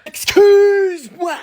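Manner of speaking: very high, drawn-out squawk; incredulous reaction. Acted. Parent hearing child is late, incredulous. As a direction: stretch it out in high disbelief.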